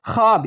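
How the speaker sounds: ख्वाब said "khaab", no w; silent waw. Shown as a demonstration. Teacher gives the spoken form, ख्वाब as khaab. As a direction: drop the w from ख्वाब. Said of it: "इसको क्या पढ़ते हैं?".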